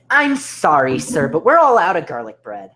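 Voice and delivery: loud, high-pitched punchline delivery; dramatic waiter. Acted. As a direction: blurt out the waiter's apology with overblown drama. Waiter's punchline after hushed horror build-up.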